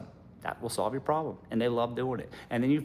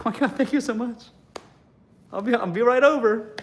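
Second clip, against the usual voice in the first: put-on gushing girlfriend; 9 semitones higher than usual.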